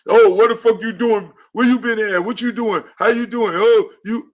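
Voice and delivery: high, loud mock interrogation; jealous yelling. Host voicing an insecure partner, mock jealous shouting. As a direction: fire accusing questions in a jealous yell. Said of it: "Daddy is never gonna be".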